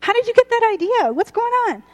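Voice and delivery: puzzled mom. Acted. Mom questioning daughter.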